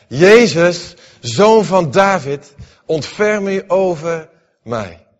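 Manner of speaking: loud, high cry, zoon van David slowed. Acted. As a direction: call desperately over the crowd. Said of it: "begon te roepen". (wanhopig luid roepen)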